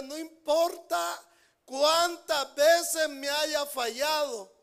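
loud, slow pleading promise; fervent preaching. Acted. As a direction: proclaim forgiveness with full force. Jesus voiced forgiving Pedro.

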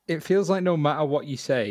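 ordinary talk, nothing marked

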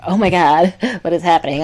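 mock giddy squeal with a laugh; giddy excited. Shown as a demonstration. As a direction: play it as a thrilled, flattered girl. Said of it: "I'm not saying I was saying it in a, like".

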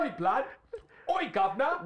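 high, loud mock London hail; bad London accent. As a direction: holler like a badly faked Londoner. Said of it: "London accents".

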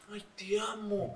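soft, slow mock-adoring sigh; a drawn-out mock fangirl swoon; gushing fan. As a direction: swoon over him like a starstruck fan. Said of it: "si ve que vos estás todo el tiempo".

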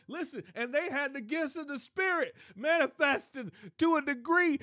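high, loud, fervent preacher's cry; fiery preaching. Acted. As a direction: preach it out at full force to the crowd. Preaching at full force.

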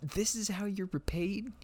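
high, theatrical consoling voice; hammed-up pity for the Forgotten; mock sympathetic speech. Mock pep talk to the Forgotten.

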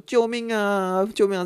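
playfully imitating a boy yelling for help; hammed-up help cry; mock cry for help. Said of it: "突然大喊说".

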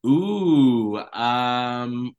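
ordinary talk

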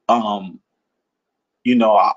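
usual speaking voice

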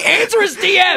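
high-pitched comic shout; mock demanding yell. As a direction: yell it as a joking plea. Mock plea yelled to absent woman.

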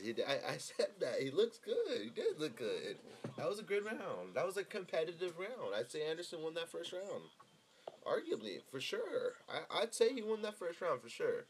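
normal talking voice

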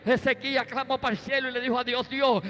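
loud, rising preacher's cry to the congregation; full-force preaching. Acted. Preacher proclaiming God's promise of healing to the church, loud and rising.

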